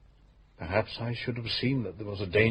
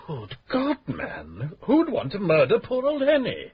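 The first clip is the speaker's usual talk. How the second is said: shocked outburst; 8 semitones higher, slower than usual. Acted. Widower shocked by murder suggestion.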